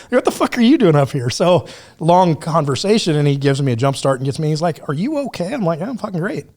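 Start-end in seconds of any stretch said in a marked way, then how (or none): 0.1-1.3 high, incredulous retold question. To the Border Patrol agent, high incredulous disbelief. 4.9-5.5 high mock-concerned question; concerned agent. Agent checking on stranded camper, high mock concern. 5.8-6.5 high, breezy, carefree listing. Retold or imitated. To the baffled agent, high breezy carefree brag.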